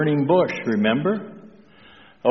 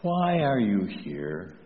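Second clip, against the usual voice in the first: softer repeat of a tender question; gentle, hushed; 3 semitones lower, 7 dB quieter than usual. Retold or imitated. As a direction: ask very softly, as a quiet little voice would. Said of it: "with that whispering voice".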